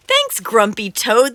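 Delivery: loud, bright group thanks; crew shouting thanks. Acted. The crew thanking Grumpy Toad, loud. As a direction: shout your thanks together. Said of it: "they shout".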